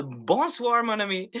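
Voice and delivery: a playful hint of a French host; mock French greeting. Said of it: "En français".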